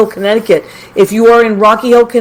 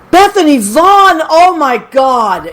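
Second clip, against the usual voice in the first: high, slow excited gush; 7 semitones higher, slower than usual. Real, not acted. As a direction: gush over a friend's name. Host excitedly greets a friend.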